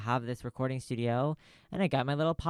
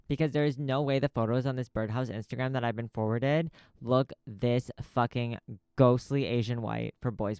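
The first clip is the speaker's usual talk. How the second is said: normal talking voice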